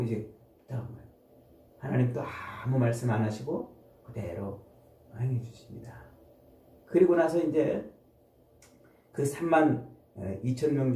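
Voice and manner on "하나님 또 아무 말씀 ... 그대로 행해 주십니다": ordinary talk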